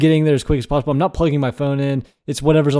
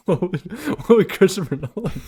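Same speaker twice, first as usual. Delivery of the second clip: higher voice, cracking up at the joke; words broken by giggles; laughing; 8 semitones higher, 4 dB quieter than usual. Real, not acted. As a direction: struggle to speak through laughter. Cracking up at the impression.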